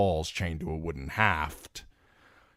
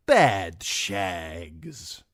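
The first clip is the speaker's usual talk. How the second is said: Timion drawling. A narrator's reading. Timion voiced.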